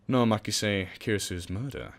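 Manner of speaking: usual speaking voice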